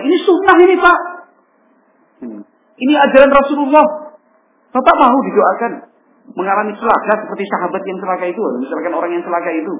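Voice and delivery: loud, high, pushy preaching at his father; a son scolding his father over religion, loud and high; harsh lecturing son. Acted.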